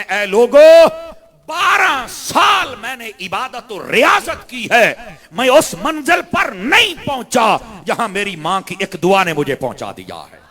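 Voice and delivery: high, loud, slow cry to the crowd; shouted proclamation. Acted. Saint tells the people of his mother, shouting. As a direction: call it out over the whole gathering.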